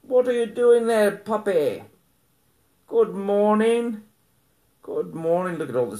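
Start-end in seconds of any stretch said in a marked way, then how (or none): 0.0-1.8 talking to puppy. Real, not acted. Talking to the dog. 2.9-4.0 high, slow sing-song good morning; puppy greeting. Real, not acted. Greeting dog, repeated.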